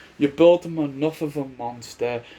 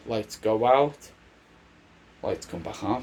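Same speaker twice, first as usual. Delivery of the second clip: ordinary talk, nothing marked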